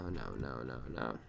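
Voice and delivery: ordinary delivery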